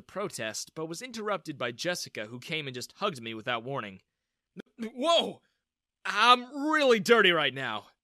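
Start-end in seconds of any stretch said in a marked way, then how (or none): 4.8-7.9 loud startled exclamation; exclaiming. A narrator's reading. Ethan exclaims as Jessica hugs him, startled. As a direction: warn her off in alarm. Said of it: "I exclaimed".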